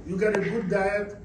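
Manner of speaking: usual speaking voice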